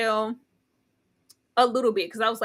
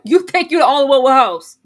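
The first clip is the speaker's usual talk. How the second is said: loud sassy Michelle; 3 semitones lower, 9 dB louder than usual. Retold or imitated. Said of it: "Michelle say".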